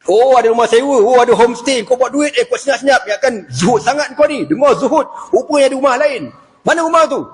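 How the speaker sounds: scorn a man chasing wealth; accusing Umar. Acted. Umar accuses Abu Darda of chasing the world, scornful. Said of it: "dia anggap Abu Darda ni kejar dunia".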